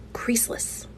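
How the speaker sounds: whispered scoff. Acted. Annoyed customer whispering the product claim to herself. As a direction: hiss the word under breath.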